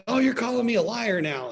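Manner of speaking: high, huffy accusation; mock indignant customer. Imitating the rude customer accusing the owner, high and indignant. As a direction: imitate an offended customer twisting her words.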